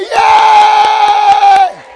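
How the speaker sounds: high, loud, long-held cheer; rousing cheer. Shown as a demonstration. Preacher leading everybody after the helper's pledge, shouted cheer. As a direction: lead the whole crowd in a big cheer. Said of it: "And everybody say".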